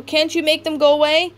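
high, loud call across the lot; father calling up to his son on the roof. A narrator's reading. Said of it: "Craig called out".

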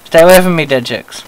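loud, high shout; shouted warning. Real, not acted. Player yelling at ghost girls, loud warning. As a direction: yell at them to back off.

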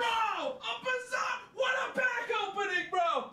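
high-pitched, strained squeal of joy; real giddy freak-out; ecstatic disbelief. Real, not acted. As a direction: squeal at an unbelievable stroke of luck. Celebrating the card pull.

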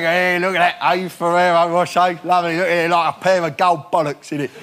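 mock Cockney geezer admiring chocolates; lightly voiced cheeky Cockney, admiring. Mock Cockney ambassador showing off chocolates, cheeky. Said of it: "a Cockney one".

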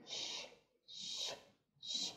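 soft, hissing "sh" with a whistle in it; whistled sh. Shown as a demonstration. Teacher demonstrating a Russian consonant, whistled "sh". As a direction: whistle each "sh" softly, without voice. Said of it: "you have to try to whistle it like".

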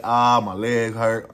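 slow, loud, hammed-up groan of pain; faked pain. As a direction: fake the pain for the detectives. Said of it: "I'm lying".

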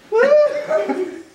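sudden high-pitched squeal of laughter. Real, not acted. Pie scalds him, bursts laughing.

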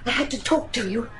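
hushed urgency. Acted. Sybil sneaking to Deacon at night.

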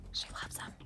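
breathy stage whisper aside. Acted. Host whispering an aside about Maya. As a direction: whisper it like a secret for the camera.

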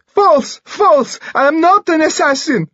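shrieking, very high panicked denial; scream. A narrator's reading. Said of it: "Dimitri screamed".

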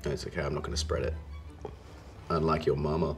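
ordinary talk, nothing marked

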